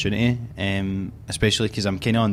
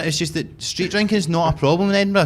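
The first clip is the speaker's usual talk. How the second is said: usual speaking voice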